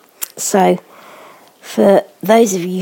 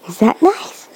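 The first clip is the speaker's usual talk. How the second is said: whispered question; 2 semitones higher than usual. Real, not acted. Mother asking her baby about the food, whispering.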